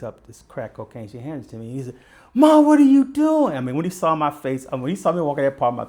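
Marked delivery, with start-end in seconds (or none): 2.3-3.6 high, loud, slowed cry of disbelief; shocked cry. Retold or imitated. Son cries out as his mother hands the drugs over, high loud disbelief. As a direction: yell at mom in betrayed shock.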